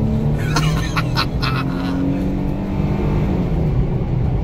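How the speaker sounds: loud surprised burst of laughter; delighted laugh. Real, not acted. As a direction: laugh out loud in thrilled disbelief. Laughs after throttle surprise.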